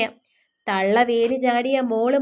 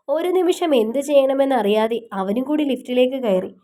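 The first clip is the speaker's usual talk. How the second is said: ordinary delivery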